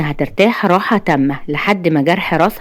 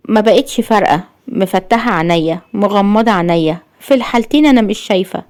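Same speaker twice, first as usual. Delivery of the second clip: ordinary delivery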